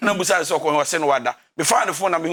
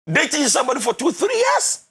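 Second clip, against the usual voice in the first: exclaiming; 8 semitones higher than usual. Real, not acted. Exclaiming at wasted years.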